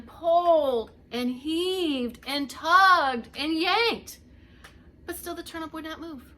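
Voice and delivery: slow and loud, heaving; straining effort. A narrator's reading. Both old folks tugging together, loud strained heave. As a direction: pull the words out with effort.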